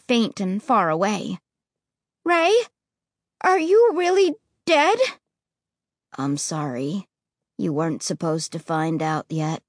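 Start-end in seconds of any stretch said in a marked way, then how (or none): 2.2-5.0 rising scared voice; frightened high question. Acted. Terrified question to ghost. 6.1-9.6 low, quiet heavy voice; sorrowful ghost boy, low and soft. Acted. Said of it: "his voice floating low and heavy".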